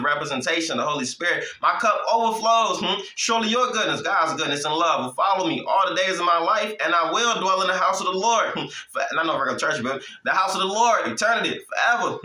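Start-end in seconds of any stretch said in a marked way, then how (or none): 1.6-12.2 sermon-style recital of the psalm; scripture recitation. Acted. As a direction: recite the psalm with feeling, pitched up.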